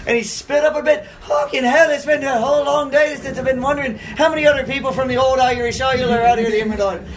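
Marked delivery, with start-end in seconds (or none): 1.3-7.0 high, excited Irish brogue; imitated Irish chatter, pitched up; Irish accent. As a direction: gush like an Irishman meeting a countryman. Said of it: "I heard the Irish accent".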